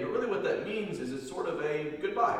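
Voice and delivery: ordinary delivery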